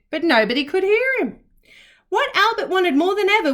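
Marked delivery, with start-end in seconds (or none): none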